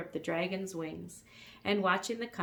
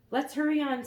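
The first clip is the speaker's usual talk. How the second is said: raised urgent dragon; 8 semitones higher, 7 dB louder than usual. A narrator's reading. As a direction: hurry it, higher and louder. Dragon voiced.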